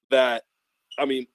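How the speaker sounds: normal talking voice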